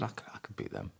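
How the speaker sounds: whispered under the breath, half to himself; a quiet confident whisper of "I could beat them"; whispered boast. Retold or imitated. Husband whispering to wife in the pub, confident.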